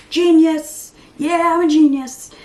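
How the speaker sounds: loud, high self-congratulating yell; mock genius cheer. Vlogger cheering herself for a right Jeopardy answer, loud mock triumph. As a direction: crow it like you just won.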